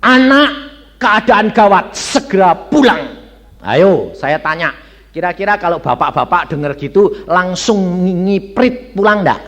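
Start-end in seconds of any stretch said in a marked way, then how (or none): 0.0-3.2 loud, high telegram readout; emphatic retold summons; urgent telegram. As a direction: announce urgent news in a raised voice. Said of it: "sudah ditelegram".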